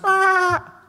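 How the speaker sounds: high thin wail of a newborn; baby cry. Acted. Narrator imitates newborn baby's high cry. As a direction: squeal like a crying infant. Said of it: "anak ini nangis".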